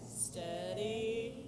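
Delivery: soft, slow self-coaching; hushed steadying. Real, not acted. Speaker moving down cautiously, murmuring steady to self. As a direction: draw it out softly and carefully.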